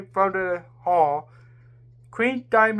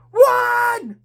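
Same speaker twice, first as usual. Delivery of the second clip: high, loud cry; yell; 6 dB louder than usual. A narrator's reading. Queen yelling in alarm at the monster. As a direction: scream it out in alarm. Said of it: "Queen Diamond yelled".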